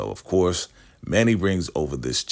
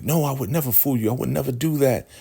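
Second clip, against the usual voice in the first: higher, rushed mock protest; hasty denial; 8 semitones higher, faster than usual. River protesting to the highest that he'd never fool him, hurried. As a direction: deny it fast and nervously. River denies fooling the leader.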